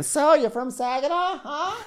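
high, mock-friendly small talk; imitating Terry chatting up a seagull, high; mock chatty high voice.

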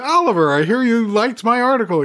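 bright, eager, pitched well up; retelling a naively cheerful greeting, pitched high; all cheerful. Retold or imitated. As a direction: gush at him like an eager fan. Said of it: "I'm all cheerful".

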